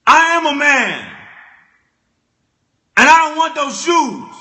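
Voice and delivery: a hint of an offended young man; mock indignant protest. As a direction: puff up and declare it. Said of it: "I was just there like".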